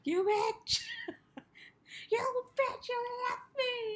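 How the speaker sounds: high, loud hammed-up scream; mock furious scream. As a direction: shriek it after the jet. Retelling Brock left behind.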